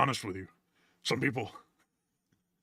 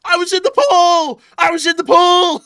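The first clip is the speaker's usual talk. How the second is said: mock yell; 13 dB louder than usual. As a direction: shriek it indignantly. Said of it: "Blairstown said".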